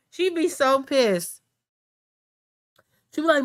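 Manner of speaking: ordinary talk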